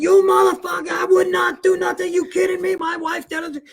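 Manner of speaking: screaming dad. Retold or imitated. Dad rages at the accusation against his wife, high yelling. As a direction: yell in outrage, trailing into gibberish. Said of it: "My dad's screaming".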